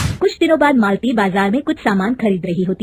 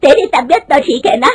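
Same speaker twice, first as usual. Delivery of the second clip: loud, higher, scolding mother-in-law; angry scolding; 5 semitones higher, 6 dB louder than usual. Acted. Mother-in-law scolds daughter-in-law for wanting a job, loud angry. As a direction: berate the daughter-in-law, voice raised. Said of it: "उसकी सास गुस्से में कहती है".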